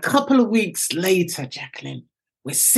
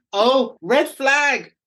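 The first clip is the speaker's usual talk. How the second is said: put-on alarmed kids; 7 semitones higher, much slower than usual. Kids suspicious, voiced.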